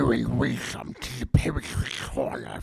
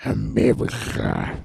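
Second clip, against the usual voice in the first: ordinary talk, nothing marked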